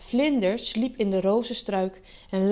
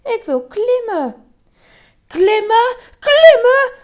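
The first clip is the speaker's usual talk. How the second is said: building to a high, loud shriek; so angry voice cracks; 14 semitones higher, 12 dB louder than usual. A narrator's reading. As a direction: insist louder each time till the voice breaks. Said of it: "zo boos dat zijn stem oversloeg".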